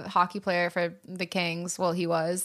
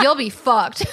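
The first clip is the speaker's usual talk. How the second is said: loud mock dad threat, laughing through it; dad's punchline; 3 semitones higher, 6 dB louder, slower than usual. Dad warning the girls at the airport, loud and laughing. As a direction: bark it like a joking dad. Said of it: "He's like".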